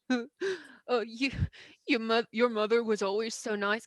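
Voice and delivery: a hint of an Australian accent, teary; lightly voiced Aussie pony. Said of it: "[name] does not have an accent".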